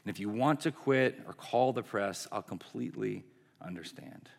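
soft, low apology with understated sorrow; retold tearful apology. Owner apologizing to the coach she betrayed, subdued and sorrowful. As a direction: apologize quietly, only a touch tearful. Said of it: "And she begins crying".

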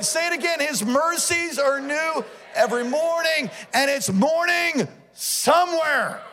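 rally the congregation with soaring, sustained cries; preacher whipping up the crowd; full-force preaching. Real, not acted.

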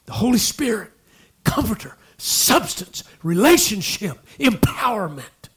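high-pitched punch on each word; emphatic listing. Real, not acted. Preacher listing the Holy Spirit's gifts, emphatic. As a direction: punch out "Holy Spirit, comforter, substance, relationship, empowerment", each falling away.

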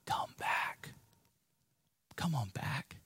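hushed, breathy coaxing whisper; tempting whisper from Satan; whispered lure. Pretend. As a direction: whisper it like a tempter calling you back. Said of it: "It's all him saying".

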